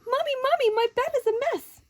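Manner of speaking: put-on high, loud, breathless child calling Mommy; excited little girl. Girl running downstairs to tell Mommy, high and loud. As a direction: call out like an alarmed little girl.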